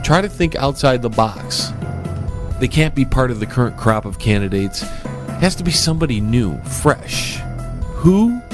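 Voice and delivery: ordinary talk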